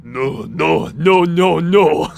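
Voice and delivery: loud, escalating yes and no shouts; hammed-up anime yelling; JoJo meme yells. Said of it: "edit that yes and no audio into JoJo".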